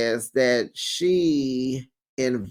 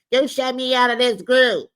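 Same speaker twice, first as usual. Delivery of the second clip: mock crying Simone; 9 semitones higher than usual. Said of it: "Here goes Simone crying".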